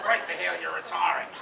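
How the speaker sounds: loud mock-Australian, laughter in it; best Aussie accent. Speaker imagines congratulating retiree Peter, mock Aussie accent. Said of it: "in my best Aussie accent".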